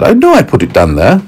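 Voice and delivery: puzzled lady. Acted. Lady looks for the carrot.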